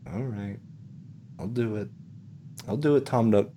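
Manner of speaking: soft, defeated surrender; resigned. Acted. Homeowner giving in to the salesman, soft resignation. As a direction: give in quietly, with pauses between phrases. Player gives in to the threat.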